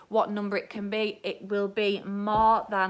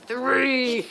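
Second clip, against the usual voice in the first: scary voice; 3 semitones higher, 4 dB louder than usual. Pretend. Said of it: "a scary voice".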